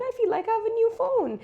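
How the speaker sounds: high, thrilled squeal; giddy with a fresh case; delighted. Retold or imitated. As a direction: gush like it's brand new. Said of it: "And I was like".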